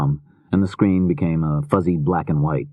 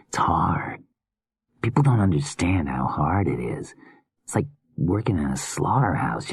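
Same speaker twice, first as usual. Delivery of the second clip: drained, hushed confession; weary whispering killer; 4 dB quieter than usual. Acted.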